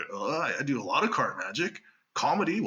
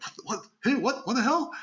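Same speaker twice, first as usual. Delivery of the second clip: surprised confusion; 12 semitones higher than usual. Retold or imitated. Confused audience reacts.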